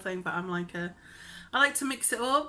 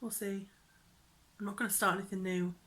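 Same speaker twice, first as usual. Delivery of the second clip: ordinary delivery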